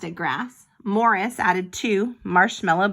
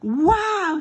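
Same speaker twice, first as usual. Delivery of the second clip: excited wow; 9 semitones higher, 4 dB louder than usual. Acted. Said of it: "said Penelope".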